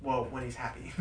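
normal talking voice

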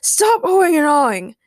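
imperious royal command. A narrator's reading. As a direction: order the crowd to stop. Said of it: "commanded King Ray".

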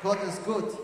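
loud call to the congregation; rousing loud praise call; preacher's call. Real, not acted. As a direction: shout it out to the church.

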